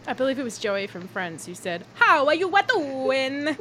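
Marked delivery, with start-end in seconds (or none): 1.9-3.6 slow, loud, higher hammed-up Italian accent; exaggerated Italian accent. Co-host mimicking a bad Joey from Friends, exaggerated Italian accent. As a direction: stretch it out in cartoonish Italian. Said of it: "It's really exaggerated Italian accent".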